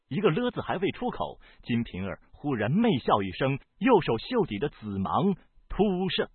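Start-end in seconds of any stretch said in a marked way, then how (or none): none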